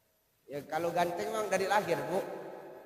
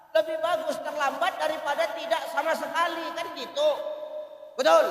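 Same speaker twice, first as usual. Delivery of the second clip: rousing shout; 6 semitones higher, 4 dB louder than usual. Real, not acted. Preacher rousing congregation.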